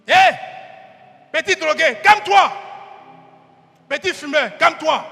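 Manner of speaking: loud, scolding hush; crowd hushing. Acted.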